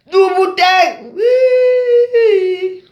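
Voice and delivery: very high, loud, sobbing cry; crying shout. Real, not acted. Sobbing cry of her friend's name. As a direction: wail the name out.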